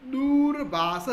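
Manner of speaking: दूरभाष spoken loud and very slow, each syllable "duu-ra-bhaa-sha" apart; a teacher's slow, loud model of दूरभाष; slow clear model word. Shown as a demonstration. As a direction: stretch out दूरभाष loudly for the class. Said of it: "फिर next है".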